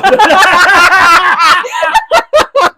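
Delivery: a big shrieking laugh that explodes out; loud burst of laughter. Real, not acted. Laughing at her friend's mix-up about the cup, a loud shriek. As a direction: crack up hard, full voice.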